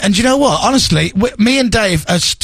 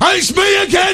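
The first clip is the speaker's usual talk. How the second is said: high, shouted mock greeting; hammed-up high yell; 13 semitones higher than usual. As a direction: call out in a silly high voice. Mocking a woman passing by.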